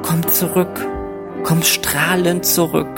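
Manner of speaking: normal talking voice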